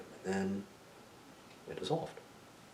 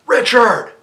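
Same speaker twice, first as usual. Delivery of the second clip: high, full-voiced call across a distance; yelling out; 19 dB louder than usual. Man on a porch calling to the storyteller, yelling out. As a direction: holler the name out loud. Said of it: "he yells out".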